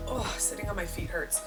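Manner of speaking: ordinary delivery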